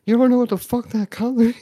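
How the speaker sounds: high, loud mock outrage; retold yelling. Doctor testing a patient's color vision, retold yelling. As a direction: imitate an exasperated yell of disbelief. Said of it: "she was yelling at me".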